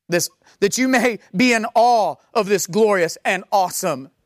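ordinary talk, nothing marked

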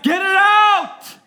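very high, very loud, stretched yell; mock desperate scream. Teen wanting the Spirit gone, mock desperate scream. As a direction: scream it as the panicking kid. Retold teen begging pastor.

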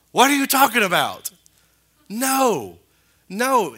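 loud, high exclamation; indignant outburst. Acted. Indignant answer to quoted objectors.

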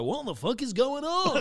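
loud-ish laughing outburst of disbelief; baffled DJ. Retold or imitated. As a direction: imitate a DJ thrown by the crowd. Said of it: "The D J was like".

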